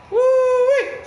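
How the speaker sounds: high, loud woo-wee whoop. Real, not acted. Streamer whoops loud and high at the crash.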